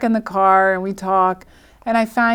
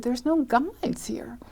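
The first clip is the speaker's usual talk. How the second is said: slow, hushed whispered aside; 3 semitones higher, 8 dB quieter, slower than usual. Retold or imitated. Noticing the party has no men: slow hushed whisper. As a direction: lean in and whisper it as a secret.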